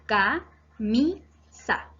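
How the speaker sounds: "Ca-mi-sa" very slowly, a pause between each syllable; teacher counting out "Ca-mi-sa" in syllables. Shown as a demonstration. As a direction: split "Ca-mi-sa" into three separate beats. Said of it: "tiene tres sílabas".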